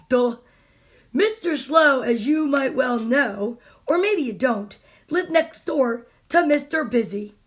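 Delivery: usual speaking voice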